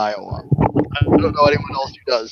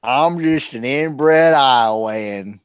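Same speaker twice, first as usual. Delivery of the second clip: mock Iowa accent; slower than usual. Shown as a demonstration. Insulting Iowa with an impression, slow mock Iowa accent. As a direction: drag it out in a mock Iowa drawl. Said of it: "here's my Iowa accent".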